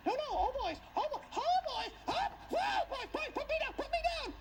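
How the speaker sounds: very high squealing falsetto pleas; pleading woman. Retold or imitated. Jezebel begging the servants not to throw her, shrill high falsetto. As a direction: squeal it like a panicked lady.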